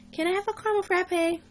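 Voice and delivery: high, wheedling little sister voice; nagging little sister. Retold or imitated. Little sister begs big sister for a frappe, nagging. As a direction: pester sweetly like a kid sister begging. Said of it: "my sister is nagging me the whole time".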